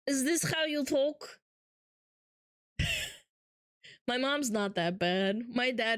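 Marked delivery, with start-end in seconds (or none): 0.1-1.4 put-on Russian accent. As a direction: tease with a mock thick Russian accent. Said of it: "You do have a Russian accent".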